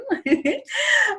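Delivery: a short burst of high laughter. Real, not acted. Host laughing at her own joke before welcoming guest, high laugh.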